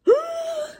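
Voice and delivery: sharp high gasp of alarm. Acted. Storyteller gasping at the wild booger. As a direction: gasp as if spotting danger.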